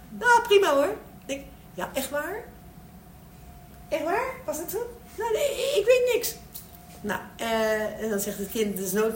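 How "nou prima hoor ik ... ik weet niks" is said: put-on very high little-kid voice; hammed-up high kiddie chatter; imitated small child. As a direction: answer as a child in a squeaky-high voice. Plays child answering parent's question.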